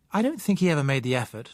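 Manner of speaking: usual speaking voice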